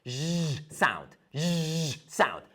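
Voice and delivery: Z sound with a long buzzing z; teacher modeling a voiced z. Shown as a demonstration. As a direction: hold the buzz on the z of Z sound. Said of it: "it's gonna be like the Z sound".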